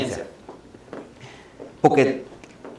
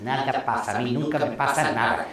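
inner voice; 4 semitones lower, 10 dB quieter than usual. Retold or imitated. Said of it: "en mi cabeza pensando".

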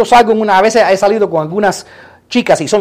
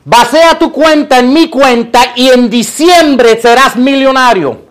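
raised, drawn-out prophetic proclamation; mock prophet; 4 semitones higher, 4 dB louder than usual. Prophet asking for a believer's money, mock proclamation. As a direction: proclaim it slowly and high, like a phony prophet. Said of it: "un profeta entra en tu vida y te dice".

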